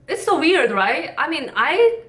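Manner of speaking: ordinary talk, nothing marked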